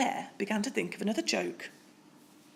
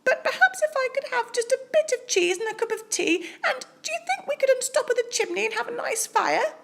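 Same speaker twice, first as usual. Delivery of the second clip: much higher squeaky mouse; a small bright-eyed mouse piping up; tiny mouse voice; faster than usual. Acted. Polite mouse asks Bear for cheese, squeaky high.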